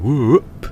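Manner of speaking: high, loud throwing whoop. Retold or imitated. Imitating Rodney throwing balls, high loud whoop. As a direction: swoop upward like a tossed ball.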